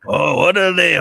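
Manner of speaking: put-on half-hearted, very American Mexican accent; poor Mexican accent. Half-Mexican guy mocking himself, failed Mexican accent.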